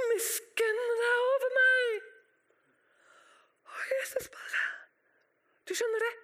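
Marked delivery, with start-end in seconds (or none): 0.1-2.1 high, slow plea for mercy; drawn-out pleading shout; crying out. Acted. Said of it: "han ropte bare enda høyere". 3.7-4.6 breath-light whispered narration; sudden near-voiceless hush; hushed awe. A narrator's reading. Hushed reaction after the plea.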